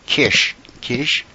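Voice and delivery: "kish" with a short i; "kish" with a short vowel, then questioning it; mispronounced quiche. Shown as a demonstration. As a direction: say "kish", rhyming with dish. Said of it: "kish is something that you make fun of".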